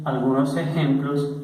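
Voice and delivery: normal talking voice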